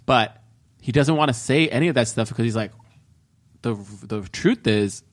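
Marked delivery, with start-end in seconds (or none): 3.6-5.0 low, slow, halting admission; acted confession. Voices man's reluctant confession.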